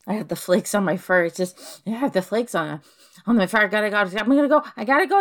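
usual speaking voice

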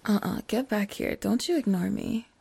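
normal talking voice

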